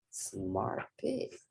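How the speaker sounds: usual speaking voice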